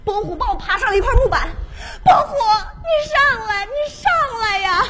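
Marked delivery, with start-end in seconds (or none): 2.0-4.8 much higher, louder crying call; hammed-up Titanic cry; begging him to climb aboard, sobbing; mock tearful plea. Begging lover in water.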